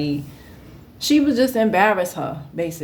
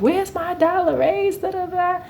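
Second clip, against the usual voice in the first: high, whiny mock demand; mocking Diane; 11 semitones higher than usual. Diane demanding her raise, high and whiny mock. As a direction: whine it like a greedy coworker. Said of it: "Diane was like".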